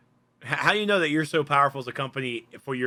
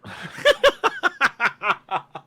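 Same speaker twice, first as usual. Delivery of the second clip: loud, high burst of laughter; long helpless laugh; 9 semitones higher, 4 dB louder than usual. Real, not acted. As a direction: laugh hard at the joke. Reaction to the accent bit.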